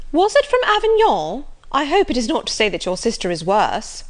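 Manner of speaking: high, loud busybody opening, easing down; prying Mrs Jennings. Acted. Mrs Jennings asking the Colonel about his letter, prying. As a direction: press the Colonel in a high, inquisitive voice.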